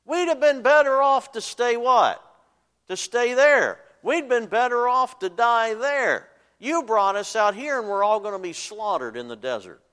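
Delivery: high, loud, whiny complaint to Moses; complaining Israelites. Acted. Preacher voicing the Israelites crying out to Moses, high and whiny. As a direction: cry out like frightened people blaming their leader. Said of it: "And they said".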